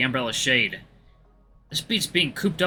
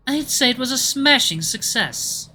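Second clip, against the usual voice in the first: put-on giggly British accent; 11 semitones higher, 3 dB louder, slower than usual. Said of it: "Fox giggles slightly under every word".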